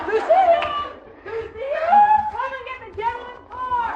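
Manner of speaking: high calls across the motel; calling out. Acted. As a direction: call for the porter from afar. Landlady calling out for the porter.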